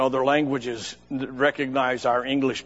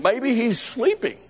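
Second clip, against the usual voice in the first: mocking taunt; 10 semitones higher than usual.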